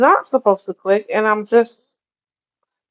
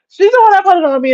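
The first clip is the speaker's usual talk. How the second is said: laughing; 11 semitones higher, 6 dB louder, much faster than usual. Real, not acted. Laughing at her own mistake.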